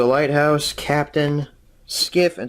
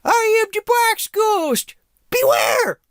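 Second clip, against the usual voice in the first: much higher, louder, slow ghostly bellow; Box Ghost voice; 4 dB louder, slower than usual. Acted. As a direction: boom it out like a silly high ghost, slowly. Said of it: "Or should I do the Box Ghost instead?".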